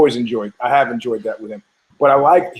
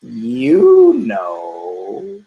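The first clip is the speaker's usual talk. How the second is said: sly parrot punchline; 2 semitones lower, 4 dB louder than usual. Acted. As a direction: stretch both words like a knowing parrot. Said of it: "The parrot goes".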